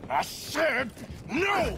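high, loud irritated yell back; angry shout. Acted. Arthur shouting back at a needling Micah, angry. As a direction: shout him down, fed up.

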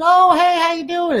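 high falsetto client greeting; mock high-pitched hello. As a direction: imitate a delighted client in a high voice. Voicing client greeting the rep.

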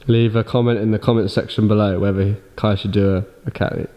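unenthusiastic. Real, not acted. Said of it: "[name] should sound more enthusiastic".

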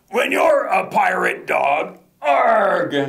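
slow, hammed-up pirate chant; pirate refrain.

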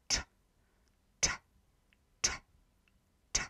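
"t" as a hard cz, tongue kept low; hard Polish cz. Shown as a demonstration. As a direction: say "t" as one hard tch sound. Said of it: "This one is where your tongue is".

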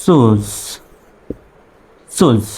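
one drawn-out syllable, সুজ, falling and buzzing at the end; correct pronunciation demo. Shown as a demonstration. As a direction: say সুজ with a z-like ending. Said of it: "হবে হলো".

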